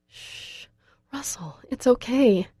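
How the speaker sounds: hushed, breathy shush to calm him; soothing whisper. A narrator's reading. Girl calming Russell who might hit her, whispered. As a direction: whisper to settle a frightened boy.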